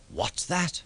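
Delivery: sharp hushed question; hissed. A narrator's reading. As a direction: hiss it under your breath. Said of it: "hissed Douglas".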